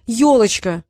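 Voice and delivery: loud, decisive repeat; insistent exclamation. A narrator's reading. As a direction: fire the word back firmly. Insistent repeat after challenge.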